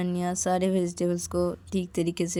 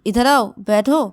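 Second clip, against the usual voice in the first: calling over; 5 semitones higher, 9 dB louder, slower than usual. Acted. Payal calls loudly.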